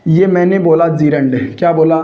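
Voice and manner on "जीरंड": "gerund" said as JEE-rund, long ee first; mispronounced gerund. Shown as a demonstration. Teacher showing students a common error, gerund mispronounced as JEE-rund. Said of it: "उच्चारण गलत करते हैं".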